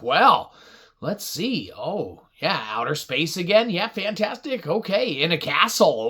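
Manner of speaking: plain reading voice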